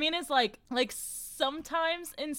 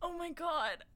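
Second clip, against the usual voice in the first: laughing through tears; 10 semitones higher than usual. Real, not acted. Laugh-crying after the tear.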